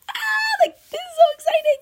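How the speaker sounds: high squealed excitement, fast; excited squeal. Retold or imitated. Podcaster retelling her excitement at the interview, squealing. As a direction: squeal it like great news. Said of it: "And I was like".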